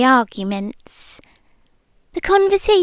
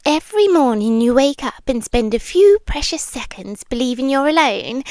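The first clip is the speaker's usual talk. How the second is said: ordinary talk, nothing marked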